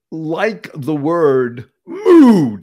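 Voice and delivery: "mood" drawn out slow and loud; stress on mood. Acted. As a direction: lean hard on "mood". Stretches the word mood.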